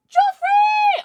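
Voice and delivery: high, loud excited cry of a name; excited shout. Real, not acted. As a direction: yell the name with real delight. Excited greeting of a named viewer.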